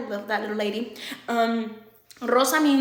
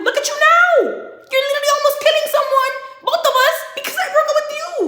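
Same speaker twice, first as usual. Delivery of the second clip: very high, loud outraged rant; retold screaming tirade at an ex; acted furious yelling; 13 semitones higher, 7 dB louder than usual. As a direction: yell it like you've been pushed too far. Acted furious outburst at boyfriend.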